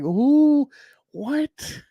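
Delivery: high-pitched squeak of total confusion; baffled disbelief. Real, not acted. Reacting to a terrible comic, squeaky baffled disbelief. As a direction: squeak it out, truly lost for words.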